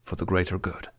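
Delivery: hushed private resolve; quiet self-reminder. A narrator's reading. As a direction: repeat it under the breath to convince yourself. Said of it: "reminded himself".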